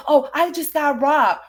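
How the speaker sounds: hammed-up victim voice; imitating the streamer's fake robbery story; mocking whine. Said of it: "And then".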